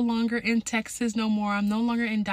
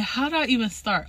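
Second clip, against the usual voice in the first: usual speaking voice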